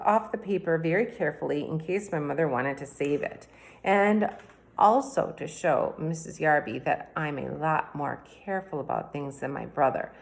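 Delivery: usual speaking voice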